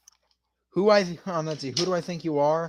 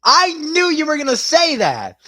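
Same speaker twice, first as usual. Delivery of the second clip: excited; 9 semitones higher, 7 dB louder than usual. Real, not acted. Reacting to chat's joke.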